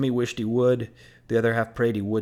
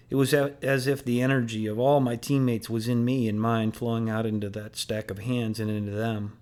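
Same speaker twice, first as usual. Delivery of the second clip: ordinary delivery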